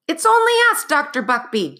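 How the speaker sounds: high, loud cry for calm; cried out. Acted. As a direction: cry it out over the din. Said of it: "Jack cried".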